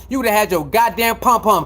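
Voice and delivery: ordinary talk, nothing marked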